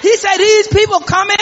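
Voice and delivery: rising, shouted call-and-response sermon; fired-up gospel preacher; preaching at full force. Acted. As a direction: whip up the church, voice climbing.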